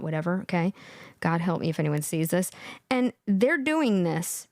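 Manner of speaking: usual speaking voice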